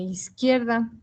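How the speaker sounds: ordinary talk, nothing marked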